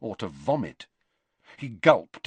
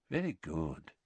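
low, soft murmur; 4 semitones lower, 11 dB quieter than usual. A narrator's reading. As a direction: praise under the breath. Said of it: "murmured Dumbledore".

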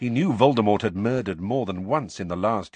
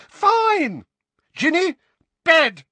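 even higher, louder scream; 10 dB louder, slower than usual. A narrator's reading. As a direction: scream the order. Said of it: "shouted Missus Weasley".

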